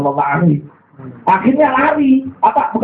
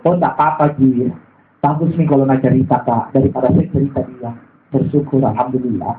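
patient, calm reply; 8 semitones lower than usual. Shown as a demonstration. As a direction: brush it off gently, grateful. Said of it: "Itu sabar itu".

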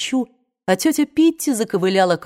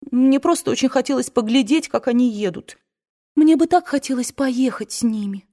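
higher, pleading, half in tears; pleading young woman; 6 semitones higher than usual. A narrator's reading. Scarlett pleads with her crying aunt, coaxing and higher. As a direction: beg the aunt to stop crying.

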